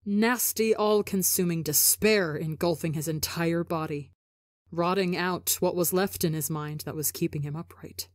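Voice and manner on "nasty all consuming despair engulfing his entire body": usual speaking voice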